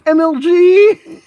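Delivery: high, loud, slow hype cry; hyped shout. Real, not acted. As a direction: yell it like a gamer hype call. Hype exclamation.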